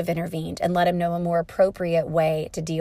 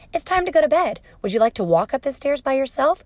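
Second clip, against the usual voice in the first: high-pitched, coaxing bedtime voice; parent to toddler; 5 semitones higher than usual. Shown as a demonstration. Parent offering bedtime choices, high and coaxing.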